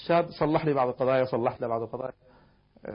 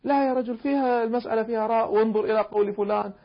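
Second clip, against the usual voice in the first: emphatic reply; 8 semitones higher than usual. Retold or imitated. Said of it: "قلت له".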